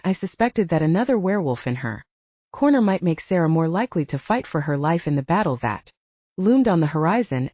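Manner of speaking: ordinary delivery, nothing marked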